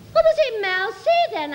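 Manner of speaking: high, prim and innocent; prim schoolgirl. Retold or imitated. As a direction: ask it sweetly, all innocence. Her quoted question.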